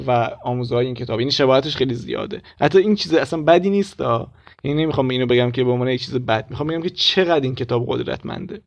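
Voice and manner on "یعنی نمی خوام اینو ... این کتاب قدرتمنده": ordinary talk, nothing marked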